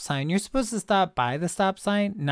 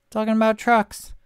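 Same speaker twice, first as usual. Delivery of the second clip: louder, higher "Talking about trucks"; exasperated repeat; 5 semitones higher, 5 dB louder than usual. Real, not acted. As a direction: throw up your hands on "Talking about trucks".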